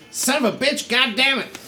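very high cursing character voice; irate halfling woman. Acted. Halfling woman cursing from behind the party, high and irate. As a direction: curse in a high, put-on squawk. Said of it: "from behind you guys, you hear".

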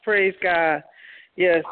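normal talking voice

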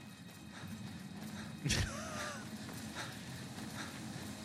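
long breathless laugh, falling; helpless laugh at yourself; high breathy laugh. Real, not acted. Laughs after failed escape.

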